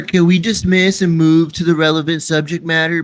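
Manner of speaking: loud, formal plea to the judge; loud mock appeal. Real, not acted. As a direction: boom it out to cut them off. Interrupting to call for order.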